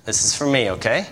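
usual speaking voice

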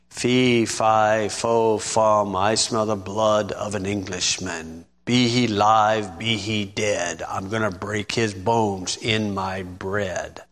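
low storybook giant chanting; low, heavy ogre, slow on the last lines. Acted. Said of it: "A giant".

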